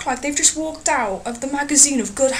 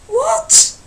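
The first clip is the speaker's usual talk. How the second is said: shocked what; 6 dB louder than usual. Acted. Outraged at tramp's moan.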